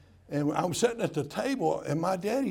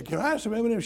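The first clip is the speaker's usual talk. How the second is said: put-on child asking; 7 semitones higher, much faster than usual. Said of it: "they say".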